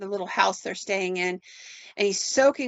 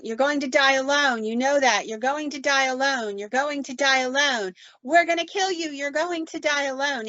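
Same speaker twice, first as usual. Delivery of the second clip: mock scream in a raised, insistent voice; retold screaming; 5 semitones higher than usual. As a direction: imitate screamed taunts, pitch climbing as they repeat. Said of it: "they're still screaming".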